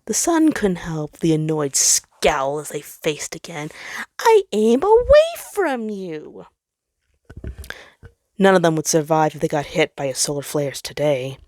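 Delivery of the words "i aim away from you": sun's voice. Acted. Sun answers coldly.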